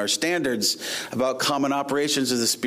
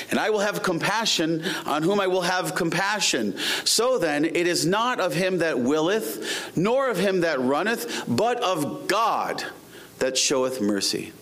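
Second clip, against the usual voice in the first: raised, declamatory Bible reading; scripture reading; 5 semitones higher than usual. Acted. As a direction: proclaim the verse to the congregation, drawing out key words. Reads scripture aloud.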